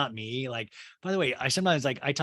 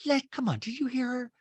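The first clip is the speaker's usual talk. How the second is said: high, pleading Rinna; all sensitive; 11 semitones higher than usual. Retold or imitated. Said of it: "all sensitive".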